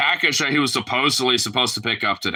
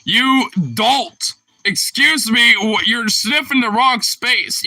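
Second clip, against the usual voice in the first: rising to high, loud mock outrage; exasperated scolding; 9 semitones higher, 4 dB louder, slower than usual. Real, not acted. Owner telling the dog he's missing leftovers, increasingly exasperated. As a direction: scold the dog, building higher and louder.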